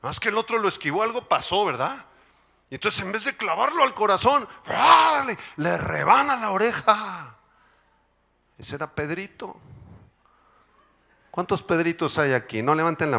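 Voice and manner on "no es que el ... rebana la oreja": fiery sermon storytelling; preaching. Acted. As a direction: drive the story home to the congregation. Predicación enérgica. (predicación enérgica)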